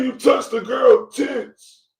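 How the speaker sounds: high, loud, slow whiny imitation; mock crying woman. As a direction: wail it like a weepy drunk girlfriend. Commentator mimics the crying woman.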